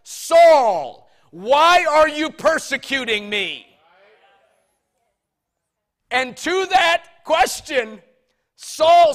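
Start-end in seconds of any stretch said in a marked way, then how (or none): 0.0-3.6 voice from heaven. Acted. Voice from heaven calls Saul.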